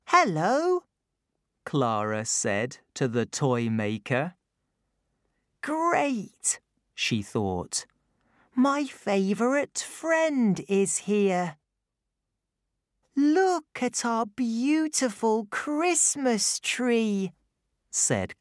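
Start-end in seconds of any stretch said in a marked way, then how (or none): 0.1-0.8 put-on high, bright child voice; little girl voice. A narrator's reading. Clara's voice in the story. 5.6-6.6 put-on high, bright child voice; little girl voice. A narrator's reading. Clara's thought. 8.5-17.3 put-on high, bright child voice; little girl voice. A narrator's reading. Clara speaks.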